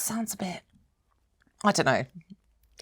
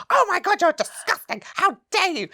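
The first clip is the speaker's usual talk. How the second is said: higher, mock-outraged retort; retold outrage; 12 semitones higher than usual. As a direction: replay the indignant telling-off, pitched up. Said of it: "And I was like".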